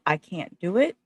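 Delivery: ordinary talk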